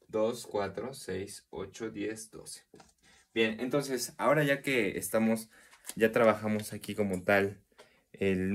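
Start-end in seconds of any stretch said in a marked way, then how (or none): none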